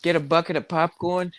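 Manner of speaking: half-hearted gruff comic impression; Bill Burr. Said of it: "I can't even sound like him".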